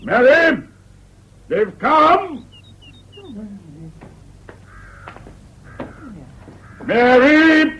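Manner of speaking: impatient, high-pitched bellow; calling out. Acted. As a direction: summon your wife from another room. Calls wife from another room.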